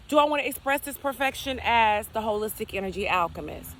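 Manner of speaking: ordinary talk, nothing marked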